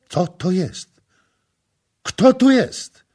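panicky, high and loud; frightened shout. A narrator's reading. Terrified governor shouting in the chaos. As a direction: cry out in terror. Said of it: "wołał przerażony nomarcha".

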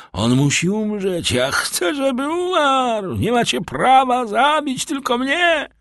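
very high, frenzied screaming. A narrator's reading. Condemned man demanding his companion die too, screaming. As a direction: scream on without letup, stretching the words. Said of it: "Skazaniec krzyczał bez ustanku".

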